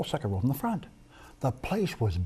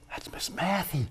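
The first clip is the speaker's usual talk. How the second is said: gasp, then a slow, hushed realization; stunned whisper of recognition; 4 semitones higher, 6 dB quieter, slower than usual. Retold or imitated. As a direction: breathe it out in astonishment. Stunned inner thought, gasping.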